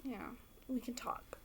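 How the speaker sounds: soft, breathy secret whisper. Real, not acted. Whispering a flirty aside to the actor.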